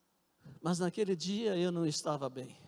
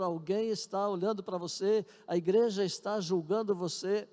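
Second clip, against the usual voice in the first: raised, driving pulpit voice over the church; preaching; 3 semitones higher, 5 dB louder than usual. Acted. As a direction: press every line on the congregation like a warning. Congregation sermon, raised voice.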